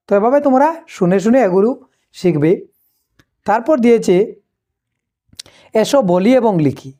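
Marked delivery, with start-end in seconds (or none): none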